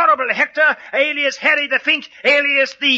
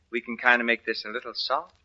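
much softer, lower, sinking almost to a hush; soft and soothing; 11 semitones lower, 9 dB quieter than usual. Acted. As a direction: ease the audience down gently. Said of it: "do something soft and sweet and soothing".

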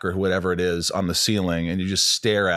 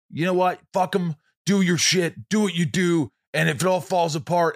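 cocky self pep talk; 7 semitones higher than usual. Retold or imitated.

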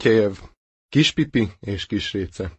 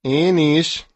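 light high chick peep; 5 semitones higher than usual. Acted. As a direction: peep it like a small bird.

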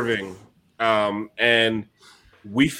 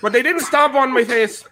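higher-pitched Irish leprechaun voice; hammy stage-Irish leprechaun; Lucky the Leprechaun; 7 semitones higher than usual. Acted. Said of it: "exclusive with Lucky the Leprechaun".

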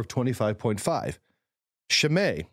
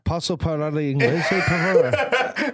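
hammed-up Italian phrase, slowing at the end; mock Italian; 2 semitones higher, 3 dB louder, slower than usual. Friend mocks the story with an Italian accent. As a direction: playfully put on an Italian accent, laughing.

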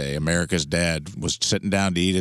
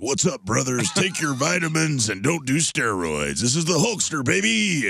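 higher, hammed-up wrestler growl-free hype; hammed-up wrestler recording hyping the callers; Hulk Hogan impression; 8 semitones higher than usual. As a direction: pump it like a wrestling promo. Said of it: "it was just a recording".

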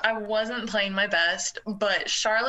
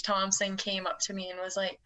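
usual speaking voice

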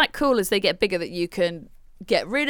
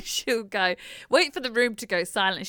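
ordinary talk

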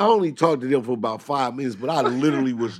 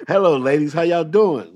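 loud, higher-pitched hello to callers; polite phone greeting; 5 semitones higher, 7 dB louder than usual. Retold or imitated. Would-be pimp greeting women on the phone, over-polite. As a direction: greet them brightly and too politely.

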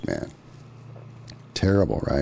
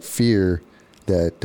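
ordinary talk, nothing marked

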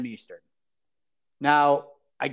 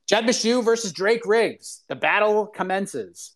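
mock fight announcer; 5 semitones higher than usual. Hyping a matchup announcement.

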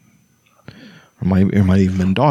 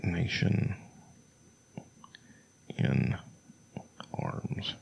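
barely audible whisper to himself; 5 semitones lower, 17 dB quieter, much slower than usual. Real, not acted. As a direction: mutter it under your breath while reading. Muttering while searching quotes.

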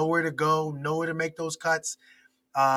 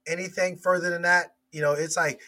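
ordinary talk, nothing marked

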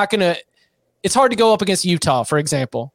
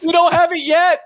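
much higher, mock quoting voice; 12 semitones higher than usual. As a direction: squeak it out in mockery. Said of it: "it just sounds funny".

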